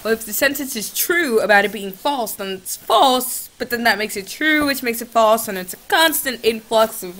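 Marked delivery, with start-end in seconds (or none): none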